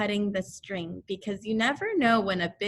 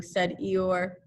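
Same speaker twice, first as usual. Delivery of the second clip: ordinary delivery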